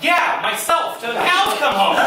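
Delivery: cocky yell breaking into laughter; mock boast. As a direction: blurt it out like a proud confession. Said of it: "And I wanted to be like".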